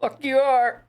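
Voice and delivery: a defiant little kid mocking dad; mock toddler. Toddler at dawn mocks dad's lie-in plan, high and bratty. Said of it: "My toddler at four A M".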